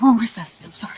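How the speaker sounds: real frightened whisper. Real, not acted. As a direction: whisper the surrender. Giving up, hushed.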